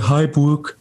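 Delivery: excited greeting. Retold or imitated. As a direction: imitate an eager hello to a friend. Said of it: "Ozzy gets super excited".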